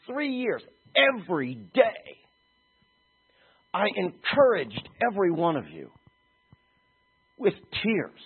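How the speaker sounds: impassioned Paul quote. Acted. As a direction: plead it like Paul to his elders.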